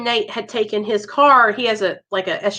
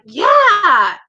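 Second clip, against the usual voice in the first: eager yes; 6 semitones higher, 6 dB louder than usual. Retold or imitated. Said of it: "And I was like".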